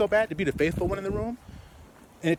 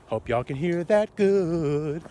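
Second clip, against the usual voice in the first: slow pointed aside; much slower than usual. Real, not acted. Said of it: "repeating slowly".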